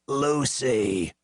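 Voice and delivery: croaked name, falling; hoarse drunk croak. A narrator's reading. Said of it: "he croaked".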